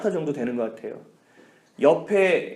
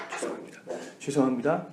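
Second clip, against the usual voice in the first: timid apology; 2 semitones lower, 7 dB quieter than usual. Retold or imitated. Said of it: "삿대질을 하면서 막".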